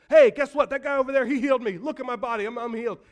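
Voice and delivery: high, very loud bursting announcement; excited shouting. Retold or imitated. Healed leper rounding the corner, shouting excitedly. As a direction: blurt the news to every passerby. Healed man telling everyone.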